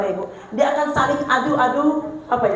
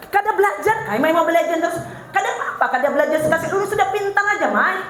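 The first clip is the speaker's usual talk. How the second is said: high, laughing mock sarcasm at the child; sarcastic mother; 4 semitones higher, faster than usual. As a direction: tease the kid in a pitched-up sarcastic voice. Mother mocking defiant child. (ibu menyindir anak yang melawan, sindiran pura-pura bernada tinggi)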